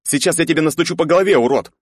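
fast, loud furious threat; angry threat. Acted. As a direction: bark it out in rage.

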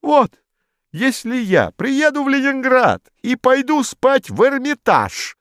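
high, loud indignant official; triumphant stuffy retort; pompous bureaucrat. A narrator's reading. Modest retorts.

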